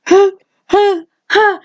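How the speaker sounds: high, breathy shocked gasps, mock; gasping huh huh. Girl hit in the face, gasping in mock shock. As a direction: imitate her gasping in shock. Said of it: "she said".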